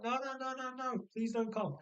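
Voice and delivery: high, rapid-fire string of refusals; hammed-up begging in a high voice; mock panicked pleading. Husband begging his wife not to come along, mock panicked pleading.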